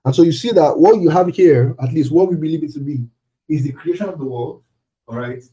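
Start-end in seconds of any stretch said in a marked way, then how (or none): none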